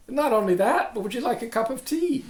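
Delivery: loud, high, playful offer; effusive mock hospitality. Teacher offers the unwanted problem tea, comically over-welcoming. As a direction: play the over-eager host.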